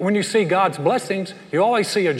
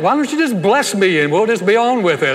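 louder, pushed-back reply; mock exasperated retort; 5 semitones higher, 7 dB louder than usual. Husband answering his wife's sneeze complaint, loud mock retort. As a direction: fire it back at her playfully. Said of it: "I'm like".

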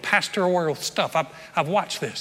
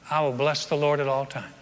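scripture reading; 4 semitones lower, 3 dB quieter than usual. Acted. Reads Psalm 34.

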